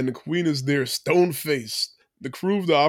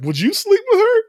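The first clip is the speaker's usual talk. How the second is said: high, loud, laughing through the question; teasing with a laugh, much higher and louder; 7 dB louder than usual. Retold or imitated. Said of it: "Then he's like".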